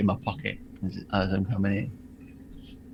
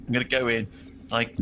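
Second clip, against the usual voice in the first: usual speaking voice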